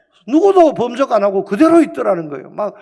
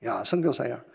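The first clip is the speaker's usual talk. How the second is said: voice of God; 9 semitones lower, 10 dB quieter than usual. Retold or imitated. God's voice answers the prayer.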